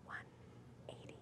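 very soft game whisper; hushed, voiceless mouthing. Pretend. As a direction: whisper each word separately. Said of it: "I'll whisper to you".

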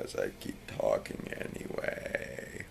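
slow, low, sleepy mumble; tired voice. Real, not acted. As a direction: talk like you can barely stay awake. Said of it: "I'm really tired".

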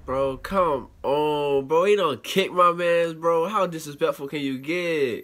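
loud, appalled complaint; indignant outcry. Real, not acted. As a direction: stretch the words out in loud, high outrage. Outraged at a kick in video.

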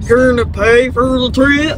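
parroting the question back in a teasing voice; imitating her voice. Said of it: "I think I sounded like that".

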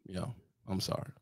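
ordinary delivery

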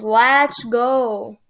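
ordinary talk, nothing marked

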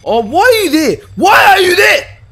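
high, loud frightened yell; terrified shout; startled scream. Real, not acted. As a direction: shriek at the sudden appearance. Jump scare in game.

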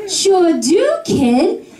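put-on thick Boston accent. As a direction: tough Boston gal reply. Her answer, Boston accent named.

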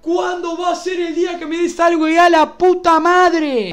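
high yell building to a loud curse; furious shout. Real, not acted. Streamer demands the game give something good, furious shouting. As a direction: demand it at full voice, exploding on the final swear.

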